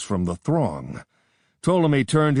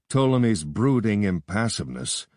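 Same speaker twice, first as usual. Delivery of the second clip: plain reading voice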